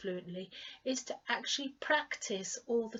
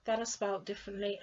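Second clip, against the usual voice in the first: ordinary talk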